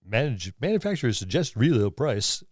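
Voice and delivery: usual speaking voice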